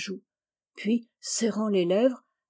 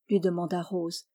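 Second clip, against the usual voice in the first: ordinary delivery, nothing marked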